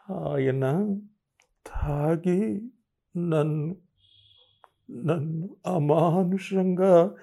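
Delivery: slow broken words, near tears; tearful, faltering. Retold or imitated. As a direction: struggle to get it out, choking up. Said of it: "చెప్పలేక కళ్ళు తుడుచుకున్నాను".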